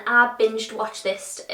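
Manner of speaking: "binged watched" drawn out slowly; slow, careful. Shown as a demonstration. Telling viewers about the show, slow careful wording. Repeats a hard phrase slowly.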